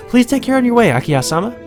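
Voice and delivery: put-on high servant voice; polite maid. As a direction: send off the master in a high, courteous voice. Reading maid's farewell in game.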